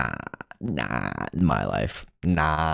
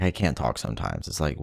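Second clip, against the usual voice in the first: ordinary talk, nothing marked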